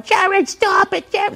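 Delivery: high-pitched mock scream, drawn out; panicked wife. Wife screaming at her husband to stop, mock panicked.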